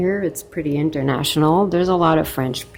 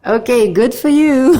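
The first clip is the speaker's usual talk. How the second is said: sarcastic; 7 semitones higher, 4 dB louder than usual. Real, not acted. Reacting to "I'm a man" note.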